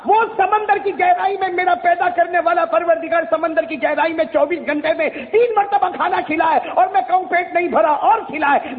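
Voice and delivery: ordinary delivery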